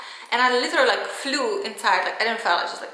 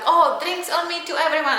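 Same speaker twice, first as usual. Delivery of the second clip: loud, high, bragging call to the bar; show-off ex; 7 semitones higher, 4 dB louder than usual. Retold or imitated. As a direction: play the ex showing off to everyone. Ex showing off at the bar.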